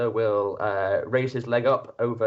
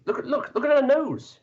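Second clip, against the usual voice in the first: high squeaky villager pointing it out; peasant crowd voice; 3 dB louder, faster than usual. Acted. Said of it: "And the crowd replies".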